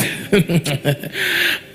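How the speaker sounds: low breathy chuckle; laughing. Real, not acted. Preacher after saying they need Christ, chuckling.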